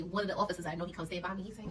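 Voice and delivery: ordinary delivery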